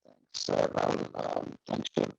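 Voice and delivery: ordinary talk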